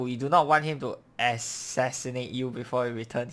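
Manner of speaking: usual speaking voice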